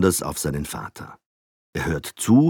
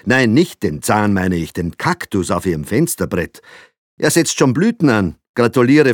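flattering small talk; 6 semitones higher, 7 dB louder than usual. A narrator's reading. Father flatters Frau Blaha.

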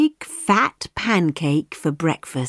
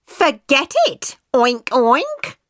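high, cheeky little voice; cheeky pancake; 8 semitones higher than usual. Acted. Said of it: "the pancake said".

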